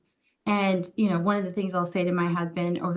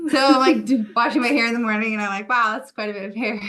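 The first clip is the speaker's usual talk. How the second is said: laughing it off, higher and louder; 5 semitones higher, 6 dB louder, faster than usual. Real, not acted. To the midwife, about losing hair: laughing. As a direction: giggle through the hair story. Laughing after welcome to motherhood.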